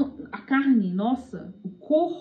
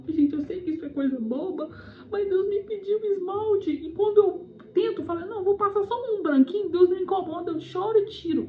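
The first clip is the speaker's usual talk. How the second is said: higher-pitched, lightly tearful retelling; imitated tearful confession; 6 semitones higher than usual. Girl confessing about nail polish, imitated as tearful. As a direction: mimic someone pleading through tears, pitched up. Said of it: "ela tava chorando, igual eu tava chorando".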